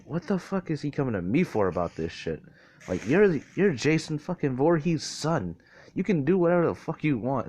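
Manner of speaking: mock-exasperated, pitch climbing as it goes; incredulous. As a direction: voice someone's baffled inner protest. Acts out Nezu's incredulous thought.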